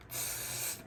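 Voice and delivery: short, soft spray-paint hiss; imitated spray-can hiss. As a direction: mimic a spray can with your mouth. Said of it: "I'm sitting there going".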